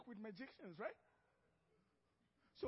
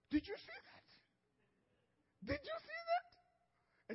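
much higher, slow, stretched-out amazement; astonished Pharaoh; much slower than usual. Retold or imitated. Pharaoh gaping as Moses' snake swallows the sticks, high and drawn out. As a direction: drag it out like a stunned king.

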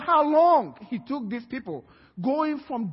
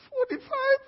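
very high squeaked question; incredulous. Acted. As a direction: echo the number in shocked disbelief. Waiting man hears the count.

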